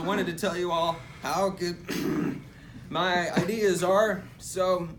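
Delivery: slow, loud speech broken by coughs; hammed-up sick speaker. Sick president thanking the crowd, slow and coughing. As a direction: push through the speech while coughing.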